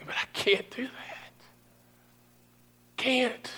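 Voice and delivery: breathy, broken whisper of grief. Retold or imitated. Pastor remembering his loss, whispering in grief. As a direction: let it fall to a whisper. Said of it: "Julie and I have often said".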